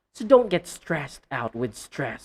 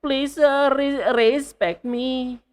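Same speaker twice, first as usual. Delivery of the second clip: high, slow RES-pect in a mock plea; exaggerated wrong stress; 8 semitones higher, 5 dB louder, slower than usual. Teacher mimics a learner pleading, exaggerated wrong stress. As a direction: drag out RES in respect, pitched high. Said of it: "So you don't say respect".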